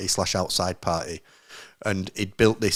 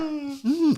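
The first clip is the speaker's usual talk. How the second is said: high, drawn out awkward hum; an uncomfortable high hmm; 4 dB quieter than usual. Retold or imitated. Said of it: "everyone else was like".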